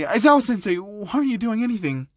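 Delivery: high, distressed appeal to the teacher; tearful worry. Acted. Student tearing up as she begs her teacher for help, distressed. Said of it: "started to tear up".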